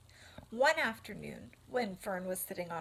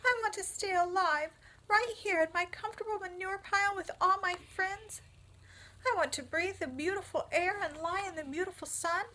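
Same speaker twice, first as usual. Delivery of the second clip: much higher, louder, fast lament; wailing piglet; 10 semitones higher, 4 dB louder, faster than usual. Acted. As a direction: wail for the life he loves. Wilbur wails his wish to live.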